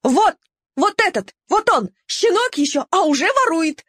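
high, yapping squeals; squealing tattletale. Acted. Mongrel leading Neptune to the puppy, squealing. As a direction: squeal it like an eager informer. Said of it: "повизгивала".

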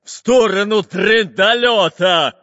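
loud, drawn-out pirate bark; Bul-Bul barking. A narrator's reading. Fat pirate captain snaps at his henchman, barking loudly. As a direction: roar the order, stretching the words. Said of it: "рявкнул толстый капитан Буль-Буль".

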